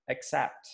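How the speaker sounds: teacher models accept as uh-ksept; accept said correctly. Shown as a demonstration. Teacher showing the class the right accept. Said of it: "the way to pronounce this correctly is".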